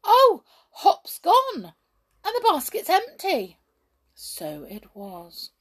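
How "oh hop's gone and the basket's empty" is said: dismayed cry. Acted. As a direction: cry out, crestfallen and shocked. Brownie finds basket empty.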